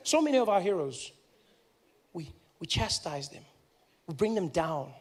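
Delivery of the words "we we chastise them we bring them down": ordinary talk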